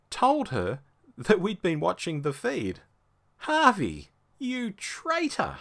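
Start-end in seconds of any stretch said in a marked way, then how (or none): 3.4-5.6 mock outrage. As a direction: call him a traitor, hammed-up.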